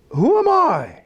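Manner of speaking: high-pitched, protesting question; acted disbelief. Acts out Moses' protest to God.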